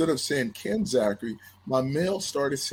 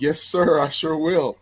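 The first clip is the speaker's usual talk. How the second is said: louder, higher, eager retold reply; happy eagerness; 4 semitones higher, 6 dB louder than usual. Young athlete answering his admired coach, happy and eager. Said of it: "I was so happy".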